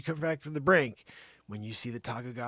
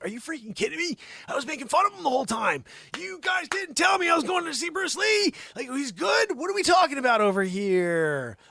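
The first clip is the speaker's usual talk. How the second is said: high, loud, fast exasperated yell; high indignant rant; outraged disbelief; 12 semitones higher, 7 dB louder than usual. Retold or imitated. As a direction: blow up at them. Retold outburst at briefing.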